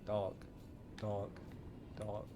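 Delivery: normal talking voice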